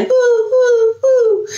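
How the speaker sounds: high hooting owl. Pretend. Owl recap.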